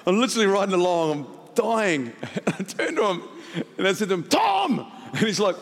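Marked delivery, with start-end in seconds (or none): 4.2-4.9 high-pitched sudden yell of a name; sudden calling out. Retold or imitated. Turning to his friend on the bike, sudden high yell. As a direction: yelp it at your riding partner out of nowhere. Calls out to riding partner.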